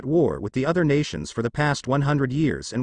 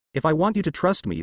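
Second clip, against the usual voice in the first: ordinary delivery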